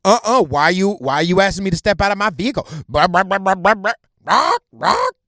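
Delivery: fast mocking backtalk turning into squawks; mock arguing woman. Mocking her arguing with the cop.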